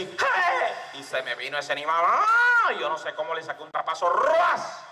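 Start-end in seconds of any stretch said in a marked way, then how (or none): 0.0-0.7 very high taunting cry; bullfighter's call. Acted. Teller provokes the bull, high short call. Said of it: "Yo hice así". 2.1-2.7 high, loud drawn-out cry; scream of fright. Acted. Teller screams as the bull charges, high alarmed cry. 4.1-4.8 high swooping whoosh word; imitated cape swipe. Teller mimics his pass at the bull, high swoosh.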